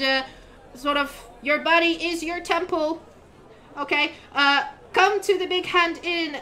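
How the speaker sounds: high, bright-voiced young crier calling out to travelers; eager town crier kid. GM as the kid crier announcing inns to travelers, high and proclaiming. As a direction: hawk the inns like a paid teenage crier.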